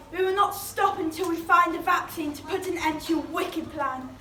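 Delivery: ordinary talk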